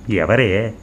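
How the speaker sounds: ordinary delivery, nothing marked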